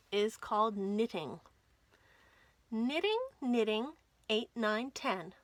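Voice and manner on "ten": usual speaking voice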